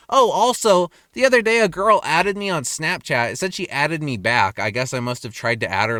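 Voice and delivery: ordinary talk, nothing marked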